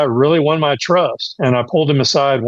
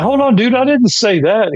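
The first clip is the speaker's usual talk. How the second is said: protesting; 10 semitones higher than usual. Retold or imitated. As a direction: jump in, caught off guard and defensive. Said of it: "And I went".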